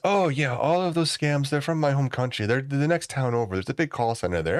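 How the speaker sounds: high-pitched imitation of the coworker. Teller mimics Nigerian coworker asking about the call, high mock voice. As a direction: pitch way up to play him. Coworker quoted via he goes.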